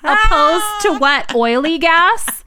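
high, loud disbelieving question; baffled, loud, high pitched; incredulous. Retold or imitated. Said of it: "and I'm like".

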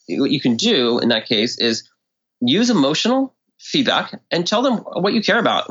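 ordinary delivery

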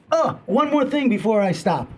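usual speaking voice

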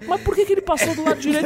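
put-on high, indignant child's voice; imitating his young son. Five-year-old son complaining about a reckless rider, high indignant child voice. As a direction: play the puzzled, offended little boy.

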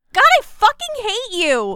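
high, loud frustrated cry; exasperated outburst. Acted. Player refusing to kill her, high and loud. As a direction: blurt it out in frustration.

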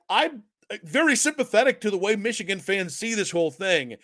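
ordinary talk

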